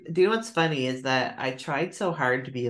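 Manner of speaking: ordinary delivery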